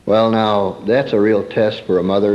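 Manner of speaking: ordinary talk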